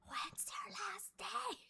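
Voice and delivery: high, drawn-out stage whisper; loud whisper. Retold or imitated. Coworker who can't whisper asks, exaggerated loud whisper. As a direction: whisper like someone who doesn't know how. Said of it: "like the whisper loud".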